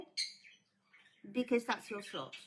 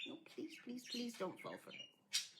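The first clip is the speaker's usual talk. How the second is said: quiet plea; 12 dB quieter than usual. Real, not acted. Speaker begging viewers not to fall for a scam, hushed plea. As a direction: beg them softly, almost under your breath.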